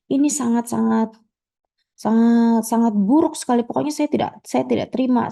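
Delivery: high, sharp scolding of the manager; a customer scolding the restaurant manager; indignant customer. Acted.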